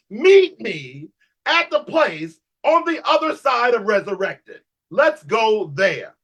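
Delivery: high, drawn-out preaching cry; slow, exalted call to the congregation; preaching climax. Real, not acted. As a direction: stretch the words out, soaring in pitch.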